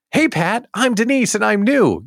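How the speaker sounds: voicing a viewer. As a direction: read her comment as the shy new arrival. Voices a viewer's chat comment.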